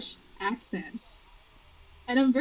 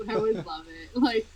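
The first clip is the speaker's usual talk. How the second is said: normal talking voice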